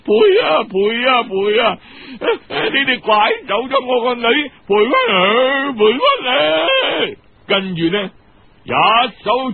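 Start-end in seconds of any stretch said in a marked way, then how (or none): 0.0-7.2 madwoman shouting. A narrator's reading. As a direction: shriek it like a crazed mother who lost her daughter. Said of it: "有個癲婆走入嚟".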